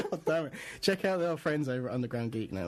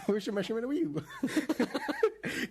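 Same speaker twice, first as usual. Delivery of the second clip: high, slow comic voice through laughter; laughing, drawn-out mock character voice; silly put-on voice; 11 semitones higher, much slower than usual. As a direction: stretch it out high in a goofy voice. Silly mimicked riff on odd word.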